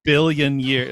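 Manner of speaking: usual speaking voice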